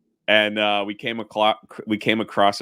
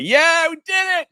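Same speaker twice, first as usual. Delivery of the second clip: much higher, louder victory shout; triumphant cheer; 6 dB louder, slower than usual. Retold or imitated. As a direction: cheer like you just sank their ship. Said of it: "And we're like".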